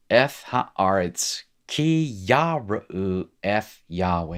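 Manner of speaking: slow, syllable-by-syllable Hebrew reading accent; own Hebrew accent. Shown as a demonstration. Teacher continues reading the exercise for students, slow accented Hebrew. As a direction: read out in slow, careful Hebrew. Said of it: "Here's my accent".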